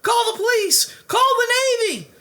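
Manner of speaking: very high, loud panicked shriek; terrified coach shrieking. Acted. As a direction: scream in terror at the monster.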